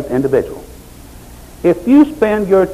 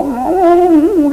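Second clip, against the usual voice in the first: high purring mm; mock cat hum; contented cat hum; 13 semitones higher than usual. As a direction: purr like a stroked cat. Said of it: "just like an old cat".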